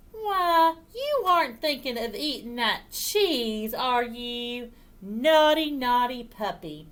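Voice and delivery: high, loud start of mock reproach; child chiding her puppy; scolding a puppy. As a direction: tut at a naughty dog. Child scolds the dog in story.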